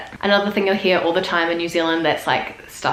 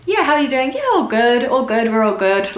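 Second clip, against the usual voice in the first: Kiwi chat; 7 semitones higher than usual. Shown as a demonstration. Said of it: "also just like".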